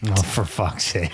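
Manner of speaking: low, slow, fed-up sigh of a line; exasperated groan. Real, not acted. Husband groaning at his wife's complaint, exasperated and slow. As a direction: drag it out like he's heard this before. Husband reacts to being blamed.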